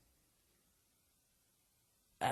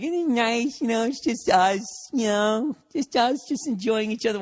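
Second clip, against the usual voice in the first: put-on higher, slow, sweetly cooing girlfriend; mock girlfriend voice; 8 semitones higher, 5 dB louder than usual. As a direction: imitate the girlfriend gushing over a cozy night. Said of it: "your girl gets all excited and is like".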